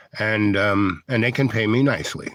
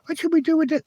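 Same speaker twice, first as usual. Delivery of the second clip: much higher mock helpless question; mock clueless; faster than usual. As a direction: ham up a baffled manager. Mocking clueless project people.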